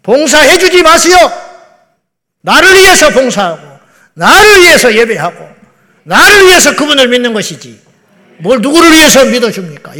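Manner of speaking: loud, high-pitched pulpit challenge; full-force preaching. Real, not acted. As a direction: thunder it at the congregation.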